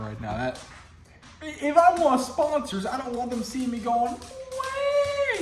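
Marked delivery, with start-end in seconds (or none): 4.1-5.4 put-on high wailing baby cry; mock baby wail. Showing what sponsors shouldn't see, high baby wail. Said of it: "crying like a baby".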